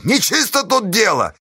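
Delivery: high, accusing cry; straining yell. A narrator's reading. Pozdey crying foul to the men, straining yell. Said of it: "надрывался Поздей".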